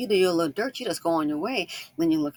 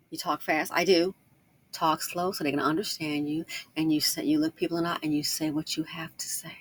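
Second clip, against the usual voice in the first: ordinary delivery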